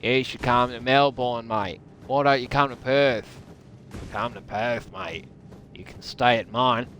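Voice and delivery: matey put-on Aussie accent; Australian accent. Australian inviting a mate to stay, put-on Aussie accent. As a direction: invite them over in broad Australian. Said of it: "Or other Australian terms".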